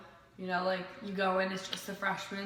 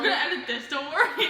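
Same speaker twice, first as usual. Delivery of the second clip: giggling while talking; laughing; 8 semitones higher, 9 dB louder than usual. Real, not acted. Giggling after interrupting.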